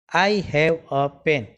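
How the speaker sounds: very slow, word by word; slow example sentence. Shown as a demonstration. Teacher reading an English example to students, very slow. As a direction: spell out each word for the class. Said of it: "article એનો મતલબ પણ એક જ થાય".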